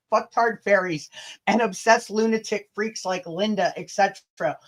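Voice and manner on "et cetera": normal talking voice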